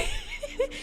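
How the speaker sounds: high squealing burst of laughter; shrill giggle fit; shrieking laugh. Real, not acted. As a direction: let out a sharp high laugh. Cracks up.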